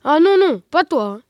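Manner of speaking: loud "oh no" protest, hammed up; cry of dread. A narrator's reading. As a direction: cry out in storybook dismay. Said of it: "tous crièrent avec effroi".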